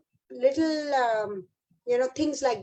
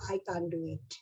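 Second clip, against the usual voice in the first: quiet self-doubt; 8 semitones lower, 11 dB quieter than usual. Retold or imitated. Teachers' limiting belief quoted.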